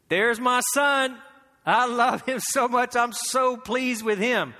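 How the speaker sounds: a proud father praising his son; proud father's voice. Acted. God the Father to his son, proudly and loudly.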